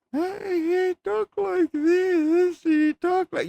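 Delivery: high, slow, put-on mock voice; mocking Charlie's Ed voice. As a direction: mimic the actor's silly voice mockingly. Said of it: "Ed didn't sound like that".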